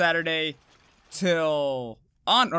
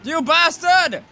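angry yell; 9 semitones higher, 8 dB louder, slower than usual. Real, not acted. Streamer yells at fleeing monster.

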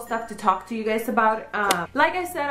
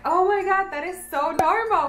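excited aunt; 8 semitones higher than usual. Retold or imitated. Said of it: "she was like".

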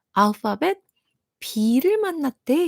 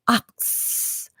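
slow breathy whisper of 악스; 8 dB quieter, much slower than usual. Shown as a demonstration. Teacher whispering the word 악스 to kids. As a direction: whisper 악스 slowly. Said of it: "그냥 악스이지만".

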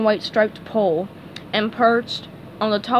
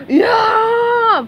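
high, drawn-out cartoon yell; full-throated acted shout; loud cry; 12 semitones higher, 7 dB louder than usual. A narrator's reading. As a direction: bellow it long and high. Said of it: "Patrick cried".

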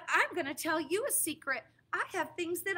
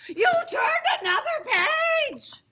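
high, loud, slow wail with a laugh; loud high distressed yell; panicked shout; 10 semitones higher, 8 dB louder than usual. Acted. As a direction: cry out in horror. Grover panics again.

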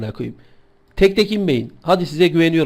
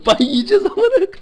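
high-pitched laughing line; 13 semitones higher than usual. Real, not acted. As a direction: laugh through the words. Laughing after cheering.

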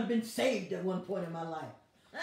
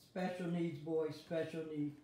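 low, much softer teasing aside; muttered aside; 8 semitones lower, 9 dB quieter than usual. Real, not acted. Preacher teasing a commenter in a soft aside. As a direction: drop it under your breath.

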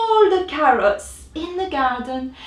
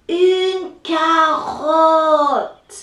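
drawn-out, louder carrot reveal; slow reveal; 5 semitones higher, 6 dB louder, much slower than usual. A narrator's reading. Narrator reveals to kids what rabbit wants, slow. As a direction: stretch it slowly for the big reveal.

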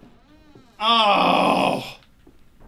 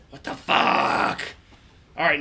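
ordinary talk, nothing marked

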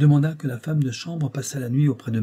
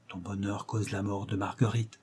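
murmured aside; 6 dB quieter than usual. A narrator's reading. Dagobert to the major at table, murmured aside. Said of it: "murmura Dagobert à l'oreille du major".

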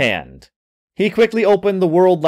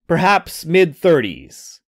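ordinary delivery

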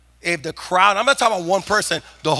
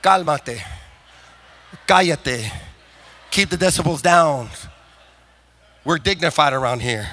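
dismissive hush; 6 semitones lower, 6 dB quieter than usual. Pretend. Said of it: "He didn't say".

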